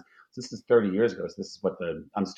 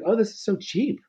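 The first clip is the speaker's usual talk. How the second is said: very high-pitched, astonished aside; mock amazement; 13 semitones higher than usual. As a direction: act out marvelling at a bargain. Retold amazement at New York prices.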